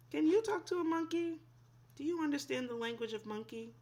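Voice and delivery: quick, bright teasing questions; playful question. Acted. Teacher to young listeners, playful and quick. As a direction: ask it with playful wonder.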